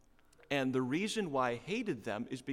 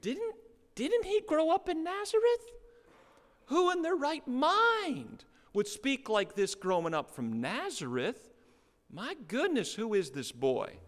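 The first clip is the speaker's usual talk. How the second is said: high, louder incredulous gasp of disbelief; astonished townsfolk; 11 semitones higher, 5 dB louder than usual. Pretend. Townsfolk to each other about Jesus, high astonished disbelief. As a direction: marvel out loud that a Nazareth kid talks like this. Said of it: "you can even imagine their question".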